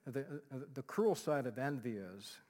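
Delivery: ordinary talk, nothing marked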